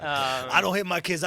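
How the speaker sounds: normal talking voice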